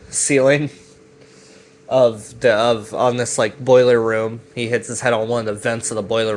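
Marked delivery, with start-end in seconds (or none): none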